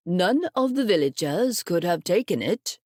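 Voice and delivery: a grandfather pondering calmly; old grandfather. Acted. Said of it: "said her grandfather".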